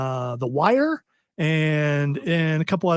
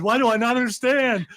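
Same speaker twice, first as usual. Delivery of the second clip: higher-pitched imitation of bewildered panic; mock panic; 7 semitones higher than usual. Imitating the new waiter lost at Odie's speech, mock panic. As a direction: act out a baffled newcomer's inner panic. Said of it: "he just looks like".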